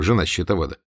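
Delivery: ordinary delivery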